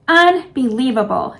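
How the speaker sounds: ordinary delivery, nothing marked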